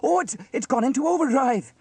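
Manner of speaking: high, loud, stammering panic; alarmed cry. A narrator's reading. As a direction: shout in alarm at the runaway machine. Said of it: "cried Sam".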